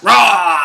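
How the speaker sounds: short loud playful "Rah!" growl; mock wolf roar. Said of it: "would have run like".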